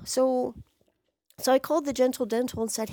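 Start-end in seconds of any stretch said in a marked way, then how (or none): none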